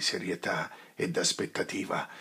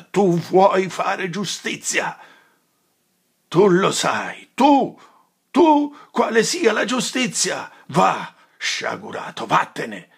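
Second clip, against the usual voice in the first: rising to much higher, louder outrage; righteous fury; thundering sermon; 4 semitones higher, 10 dB louder, slower than usual. Acted. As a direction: rebuke him like a prophet. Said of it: "voce ... pienezza e sonorità".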